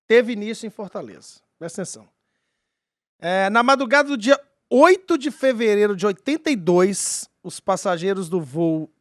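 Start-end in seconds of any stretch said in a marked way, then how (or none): 3.2-8.9 story narration. Acted. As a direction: narrate it like a mystery documentary. S0 narrates the UFO case.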